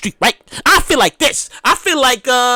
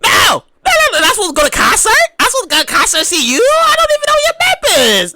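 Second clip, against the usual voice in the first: outraged yell; 8 semitones higher than usual. Real, not acted. Exasperated fan rant.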